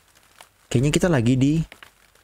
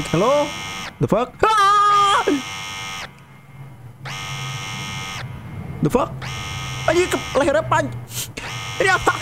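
panicked; 12 semitones higher than usual. Real, not acted. Panicking at horror game.

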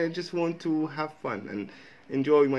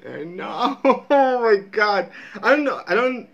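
high, loud words through real laughter; helpless laugh breaking into the speech; 9 semitones higher, 7 dB louder than usual. Real, not acted. Vlogger overcome by the accent joke, talking through laughter.

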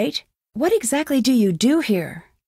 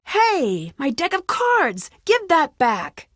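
indignant protest; 6 semitones higher, slower than usual. Acted. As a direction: object as your things are taken. Protesting as cards are taken.

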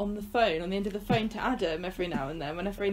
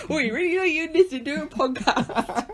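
higher, hammed-up Bristolian with laughter; giggly exaggerated Bristolian accent; mock Bristolian; 7 semitones higher, 4 dB louder than usual. Co-host riffing on podcasts in laughing mock Bristolian. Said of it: "my best Bristolian accent".

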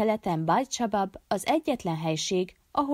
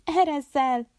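high, raised cry to break free; narrated scream, much higher pitch; shrieking; 9 semitones higher, 4 dB louder than usual. A narrator's reading. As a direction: cry out as if grabbed. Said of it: "sikoltotta Bárzsut".

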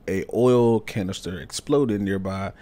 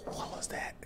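breathless unvoiced whisper; frightened whisper; 16 dB quieter than usual. Real, not acted. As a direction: whisper in the dark, scared. Hiding from monster in game.

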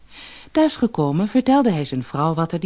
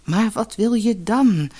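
slow, pleading higher-pitched question; unhappy; 6 semitones higher than usual. A narrator's reading. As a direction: ask helplessly, drawing the words out. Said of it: "vroeg de oude visser ongelukkig".

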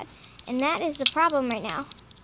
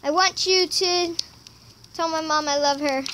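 usual speaking voice

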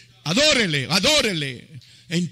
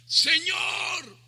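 high, stretched-out call; imitated distant shout; 9 semitones higher than usual. Peter in the boat calls to Jesus, imitated shouting. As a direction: call out across the water without full volume. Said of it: "le empieza a gritar".

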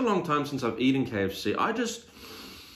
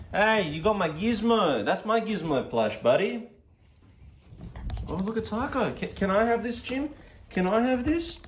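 lightly put-on higher voice; playful pet talk; 8 semitones higher than usual. Owner asks about his gizmo plush, playful higher pet talk.

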